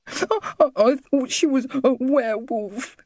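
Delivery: put-on high, small boy's voice, sobbing out the words; a frightened little boy crying; child weeping. A narrator's reading. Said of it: "Mickey wept".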